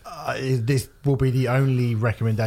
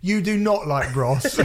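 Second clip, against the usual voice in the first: laughing disbelief; 8 semitones higher than usual. Real, not acted. Mocking the promoter while laughing.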